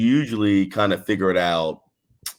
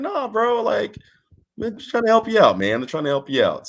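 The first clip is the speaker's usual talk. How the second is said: high-pitched retold protest; pleading reassurance; 13 semitones higher than usual. Wrestler telling bro they mean to help, pleading. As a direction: talk a friend down in a raised pitch.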